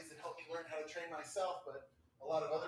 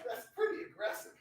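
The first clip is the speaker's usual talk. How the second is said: mock-shocked reaction; 12 semitones higher, 7 dB louder than usual. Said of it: "And I'm like".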